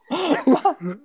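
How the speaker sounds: high real laugh. Real, not acted. Storyteller cracks up at his confused brother, laughing. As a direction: crack up at your own story.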